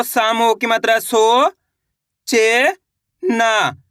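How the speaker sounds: slow "सो", "चे", "ना" with clear gaps; teacher breaking the word into "सो, चे, ना"; sounding out syllables. Shown as a demonstration. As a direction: stretch "सो" and "चे", one syllable at a time. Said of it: "इसी तरीके से इनको पढ़ा".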